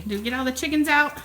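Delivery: ordinary talk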